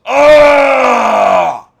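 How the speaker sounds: imitating a wrenching scream, very high and very loud; mock anguished yell, loud and high. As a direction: let out a cry of horror. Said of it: "he's like".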